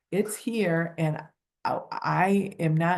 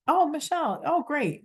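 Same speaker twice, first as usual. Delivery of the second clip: bright cheery greeting; 10 semitones higher than usual. Retold or imitated. Caller greeting the contact brightly.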